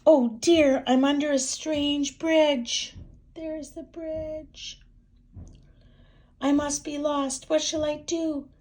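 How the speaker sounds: high, loud worried fretting; worried hen. Acted. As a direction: fret like a lost hen. Lost frightened hen.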